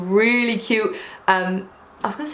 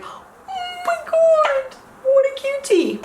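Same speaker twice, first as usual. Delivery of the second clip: much higher, slow squeal of adoration; much slower than usual. Retold or imitated. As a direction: squeal it like you just saw the cutest thing ever. Squealing over the cute old man.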